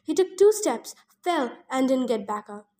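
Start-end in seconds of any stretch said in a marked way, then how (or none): none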